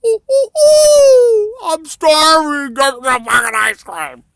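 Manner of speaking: high, loud slow wail of hunger; starving man wailing, loud and high; desperate wailing. Acted. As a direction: cry out like a starving wretch. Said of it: "Like there, see?".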